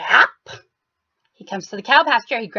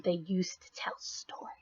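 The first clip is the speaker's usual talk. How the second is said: hushed, breathy storyteller whisper; 5 semitones lower, 19 dB quieter than usual. Acted. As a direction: lean in and whisper it.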